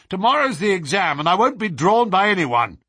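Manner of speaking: loud, high shouted refusal; angry roar. Acted. Student behind the door shouting he won't be disturbed, furious. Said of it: "roared the angry voice".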